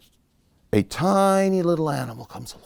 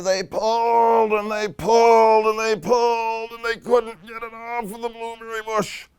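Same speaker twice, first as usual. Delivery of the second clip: straining pull; 5 semitones higher than usual. A narrator's reading. Animals work together on the stuck berry, straining. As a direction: stretch each pull out as if hauling hard.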